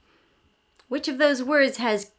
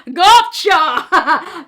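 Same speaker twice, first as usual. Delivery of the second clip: loud higher monster shout; dinosaur yell; 6 semitones higher, 10 dB louder than usual. Acted. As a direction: pounce with a yell.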